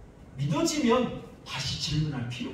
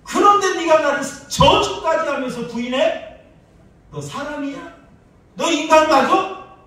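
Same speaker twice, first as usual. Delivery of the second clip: louder, higher hammed-up scolding; pretend angry rebuke; 12 semitones higher, 12 dB louder than usual. Pastor voicing an imagined Jesus scolding Peter, in a mock rebuke. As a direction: berate the disciple as he never would. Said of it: "예수님은 한마디도 그렇게 하지 않으셨어요".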